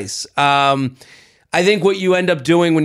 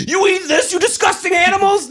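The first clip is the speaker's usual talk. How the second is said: high, mocking disgusted tourist voice; hammed-up high-pitched disgust at the dish; snooty American tourist. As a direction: sneer it like a tourist appalled by the food. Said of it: "they talk to me as if".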